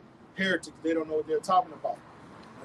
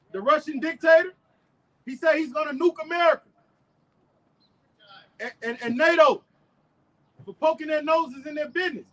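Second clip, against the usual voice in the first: high, loud street preaching; 7 semitones higher, 4 dB louder than usual. Acted. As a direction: preach it out to the crowd. Street preaching at full force.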